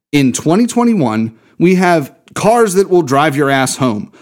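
ordinary delivery

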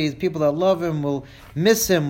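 ordinary talk

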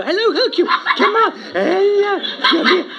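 high, loud doggy-talk greeting. Acted. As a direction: coo up high at the excited dog. Greeting the dog.

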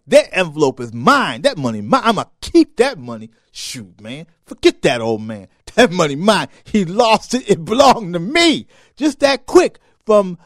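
loud, high greedy claim; greedy gloating. Retold or imitated. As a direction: grab it like it's yours. Greedy inner voice shouting.

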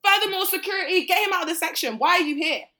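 loud, high command to the bouncer; calling out to security loudly. Retold or imitated. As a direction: order him thrown out.

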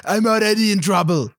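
quick hammed-up hero line; imitating Jean-Claude Van Damme. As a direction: toss off a loose impression of the action star. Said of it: "Or however he sounds".

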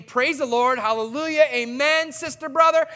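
bright, loud mock churchgoer cheer; fake cheerful praise. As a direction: play the fake-smiling believer, a bit loud. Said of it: "with a smile on our face saying".

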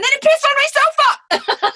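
high, loud squeal through laughter; outraged shriek. Real, not acted. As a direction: explode in comic indignation. Outraged climax of rant.

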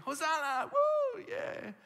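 high, loud whoop of a joyful crowd; cheering crowd. Retold or imitated. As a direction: cheer like people at a parade. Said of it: "people singing".

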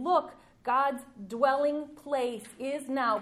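"now" drawn out slow, loud and high; stress on now. Shown as a demonstration. Preacher proclaiming the Revelation verse, stressed slow. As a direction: slowly proclaim that God's dwelling place is now. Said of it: "present tense".

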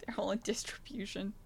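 breathy hushed echo of the joke; whisper. Real, not acted. Repeating her co-host's joke as a hushed whisper. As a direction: whisper it back like a secret aside.

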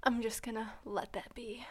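whispered aside, soft and dry. Real, not acted. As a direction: whisper it, stepping back from the joke. Dodging an awkward joke.

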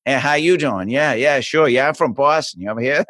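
laid-on Boston accent, R's dropped; put-on stronger Boston accent. Shown as a demonstration. New kid showing off for girls, exaggerated Boston accent. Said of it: "I put it on stronger".

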